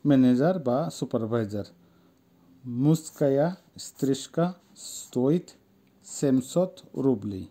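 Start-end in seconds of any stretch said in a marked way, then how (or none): 2.8-7.4 word-by-word reading with gaps; slow model sentence. Shown as a demonstration. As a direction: read it very slowly for learners. Teacher reads sentence word by word. (ধীরে, শব্দে শব্দে পড়া)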